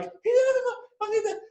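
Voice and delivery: very high, frantic squeal; freaking out. Retold or imitated. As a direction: squeal while shaking with excitement. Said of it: "freaking out in his chair. He's shaking".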